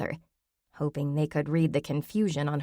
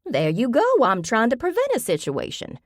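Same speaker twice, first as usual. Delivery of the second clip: raised, louder firm declaration; declaring; 7 semitones higher, 5 dB louder than usual. Acted. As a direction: state it like the case is closed. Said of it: "Grammy declared".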